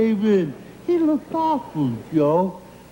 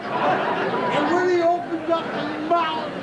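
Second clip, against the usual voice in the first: ordinary delivery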